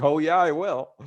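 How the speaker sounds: cocky comeback, re-enacted; retold defiance. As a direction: act out talking back boldly. Retold defiant reply to the Greys.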